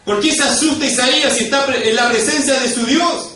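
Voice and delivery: high, loud, urgent questions; fervent pulpit shouting; impassioned preaching. Real, not acted. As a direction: drive each question home at full force. Preacher dramatizes Isaiah's terror.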